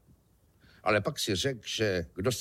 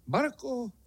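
high-pitched questioning call; tázavé volání; 14 semitones higher than usual. Acted. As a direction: call her name high, hoping for an answer. Kubát volá na bezvládnou Barku. (vysoko posazené tázavé zavolání)

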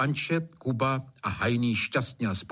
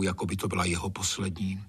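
ordinary delivery, nothing marked

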